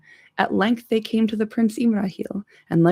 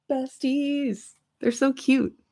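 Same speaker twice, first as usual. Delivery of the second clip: gushing; 7 semitones higher, slower than usual. Real, not acted. Gushing aside about elf and dwarf.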